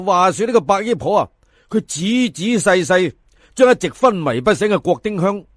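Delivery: ordinary delivery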